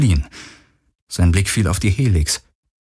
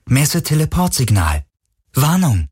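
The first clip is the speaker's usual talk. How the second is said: higher-pitched system announcement; ship computer; 5 semitones higher than usual. A narrator's reading. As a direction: announce the alert like an onboard computer. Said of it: "meldete die Jezebel". (Meldung des Bordcomputers, höher)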